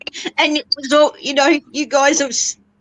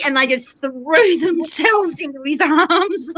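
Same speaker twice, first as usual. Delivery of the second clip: higher voice breaking into laughter; overjoyed laughing; 5 semitones higher than usual. Real, not acted. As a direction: tell it through joyful laughter. Overjoyed, laughing while telling reunion.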